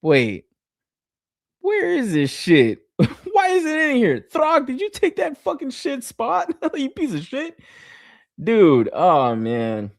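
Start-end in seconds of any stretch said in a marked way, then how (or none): 0.0-7.6 mock outrage. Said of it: "I was like".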